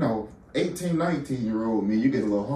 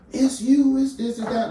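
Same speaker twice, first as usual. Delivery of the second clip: high, loud imitation of a coach yelling; mock coach hollering; 12 semitones higher, 5 dB louder than usual. Retelling the new coach hollering at him, high mock yell. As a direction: ham up the new coach barking at you. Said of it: "trying to holler at me".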